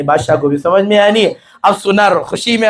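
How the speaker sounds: ordinary talk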